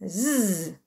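long voiced "Z" as zzz; buzzed Z sound. Shown as a demonstration. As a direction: hold "Z" as a steady buzz. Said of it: "Pour qu'il chante". (« Z » chanté : zzz prolongé)

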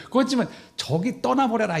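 high, mocking shoo-off; 놀리듯 높게; mock dismissal. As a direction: sent away, half joking. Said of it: "이래야 될 거 아니에요".

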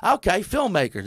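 imitating a doubtful country guy. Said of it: "He's like".

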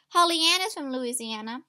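usual speaking voice

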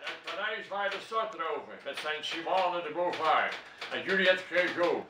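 poetry recital. Acted. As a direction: declaim a poem with punched key words. Recites own poem. (gedragen, nadrukkelijke gedichtvoordracht)

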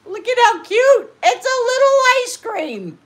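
high squeal over the cute sticker; squealing delight. Real, not acted. Opening friend mail, squealing at a cute sticker.

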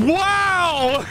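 sudden high startled cry; jolted by a scare; startled wow. Real, not acted.

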